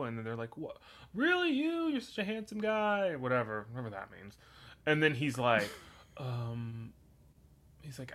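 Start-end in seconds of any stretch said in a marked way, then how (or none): 0.6-3.1 squeaky high shocked disbelief; mock disbelief.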